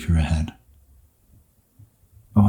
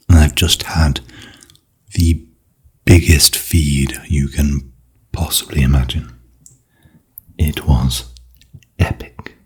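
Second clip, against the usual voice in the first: ASMR whisper; 3 semitones lower, 5 dB louder than usual. Real, not acted. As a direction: whisper close to the mic, gentle. ASMR hotel room tour.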